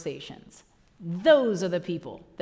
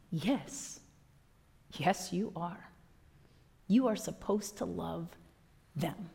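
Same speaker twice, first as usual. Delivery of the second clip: soft firm answer; 3 semitones lower, 6 dB quieter, slower than usual. Acted. Quiet answer to Judas.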